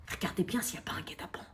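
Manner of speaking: hushed conspiratorial whisper; prank whisper. Acted. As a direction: whisper like plotting. Hushed talk at door.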